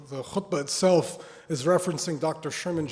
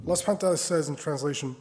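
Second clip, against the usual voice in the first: ordinary talk, nothing marked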